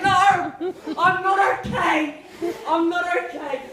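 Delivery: upset shout. Retold or imitated. Little brother crying to Angus, mock upset yell. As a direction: wail it like a hurt kid refusing comfort. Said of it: "he's like".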